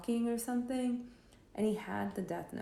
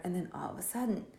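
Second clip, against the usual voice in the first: usual speaking voice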